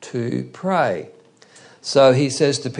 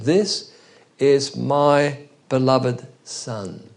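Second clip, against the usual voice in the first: solemn voice of God; much slower than usual. Acted. Voice from the cloud quoted.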